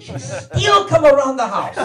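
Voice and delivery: high, loud, drawn-out grumble; indignant Italian father. Acted. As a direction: exclaim slowly like an outraged dad. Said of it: "Because my father".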